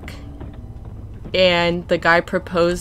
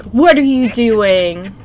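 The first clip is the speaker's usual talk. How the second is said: loud, slow, drawn-out mock challenge; stretched-out retold indignant question; affronted lady; 3 semitones higher, 7 dB louder, slower than usual. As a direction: imitate an outraged passerby confronting someone. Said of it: "he said this lady was like".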